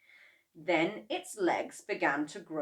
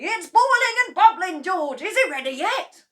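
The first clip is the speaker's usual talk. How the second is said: excited cry; 13 semitones higher, 11 dB louder than usual. A narrator's reading. Mister Cranky at the pot, loud and high. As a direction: marvel loudly.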